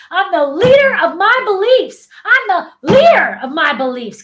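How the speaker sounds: loud, high, emphatic affirmation; like you mean it. Shown as a demonstration. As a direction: declare it with full conviction. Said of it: "say it like you mean it".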